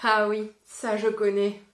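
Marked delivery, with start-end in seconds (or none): none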